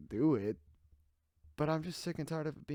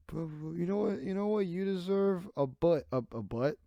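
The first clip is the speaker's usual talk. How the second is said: angry rant; 6 semitones higher, 6 dB louder than usual. Real, not acted. Mocking then yelling.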